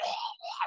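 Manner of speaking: breathy, voiceless choking gasps; mock strangled gasps; imitated choking gasps. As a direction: mimic a man being choked. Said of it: "it sounds horrendous".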